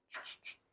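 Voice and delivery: quick whispered shh shh sounds; shushing. Acted. God hushing the believer who wants to fight, shushing. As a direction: hush someone who wants to argue.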